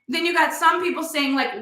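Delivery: usual speaking voice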